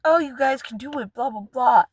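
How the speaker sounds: mock your own cheerleading, then wave it off; sarcastic sing-song imitation of self; mock cheerful pep talk. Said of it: "after being like".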